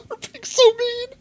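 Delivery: very high, squeaky voice between fits of laughter; laughing so hard the words squeak out; crying with laughter. Real, not acted. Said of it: "I'm crying".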